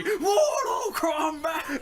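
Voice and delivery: big announcer yell, high and drawn out; booming Mortal Kombat announcer cry; imitated TV shout. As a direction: shout it like the game's intro. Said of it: "my T V just shouted at me".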